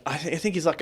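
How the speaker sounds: ordinary delivery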